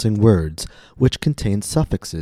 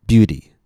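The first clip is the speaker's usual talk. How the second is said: "beauty" with its first syllable punched louder; stress on first syllable; 3 semitones higher, 5 dB louder than usual. Shown as a demonstration. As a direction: hit the first syllable of "beauty", let the second fall. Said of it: "notice how the stress falls on the first syllable".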